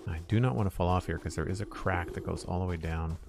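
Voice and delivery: ordinary talk, nothing marked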